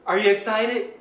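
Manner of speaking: ordinary talk, nothing marked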